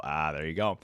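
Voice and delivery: ordinary talk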